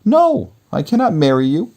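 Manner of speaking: put-on high girl's refusal. Beauty refusing the beast's proposal, high firm voice. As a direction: turn him down as the young heroine.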